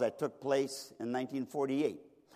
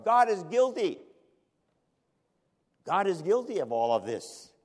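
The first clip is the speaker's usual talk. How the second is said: high, loud accusation, easing on the repeat; crying out verdict; 5 semitones higher, 4 dB louder than usual. Retold or imitated. Witnesses with one voice convict God, crying out. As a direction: proclaim guilt as one united crowd. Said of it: "with one voice ... cried out".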